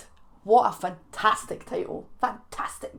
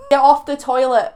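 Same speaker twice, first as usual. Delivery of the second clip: mock scolding; 4 semitones higher, 10 dB louder than usual. Scolding viewers jokingly.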